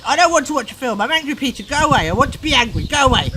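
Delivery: put-on loud, high, stroppy child shouting; angry shout. Parent voicing Peter refusing to watch, angry shout. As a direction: shout it like a furious boy.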